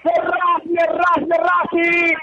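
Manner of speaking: long held goal shout; goal scream. Real, not acted. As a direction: roar the goal call. Goal call in live commentary.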